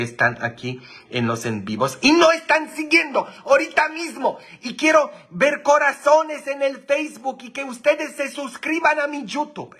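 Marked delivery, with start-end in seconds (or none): 2.0-9.8 high, loud hype shout; loud high livestream hype; excited shout. Real, not acted. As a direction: yell at the viewers to subscribe. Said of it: "demanding follows".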